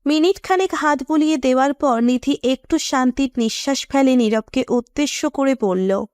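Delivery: ordinary delivery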